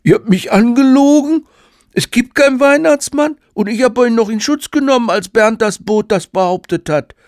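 put-on very high, loud kid's wail; outraged child. Child accuses parents of lying about Santa, outraged. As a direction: protest loudly in a squeaky child voice.

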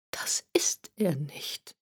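soft, slow, frightened whisper; a scared old woman whispering to herself; fearful whisper. A narrator's reading. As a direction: breathe it out in fear, barely voiced. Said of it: "flüsterte ängstlich".

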